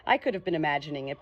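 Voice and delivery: plain reading voice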